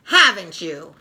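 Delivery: angry accusation at Linus; fuming. Acted. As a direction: scold him for letting her down. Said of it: "Sally fumes".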